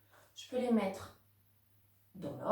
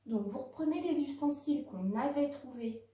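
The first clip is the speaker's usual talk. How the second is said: normal talking voice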